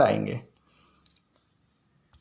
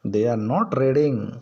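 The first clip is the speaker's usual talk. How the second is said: very slow dictation; much slower than usual. Shown as a demonstration. Teacher dictates the translation.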